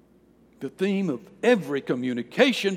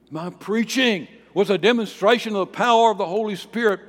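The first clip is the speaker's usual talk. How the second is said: forceful preaching; 6 semitones higher, 6 dB louder than usual. Acted. Preacher quotes Paul, full force.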